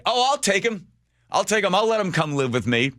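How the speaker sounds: high, eager mock offer; mock eager volunteer. Imagined eager volunteers offering to adopt.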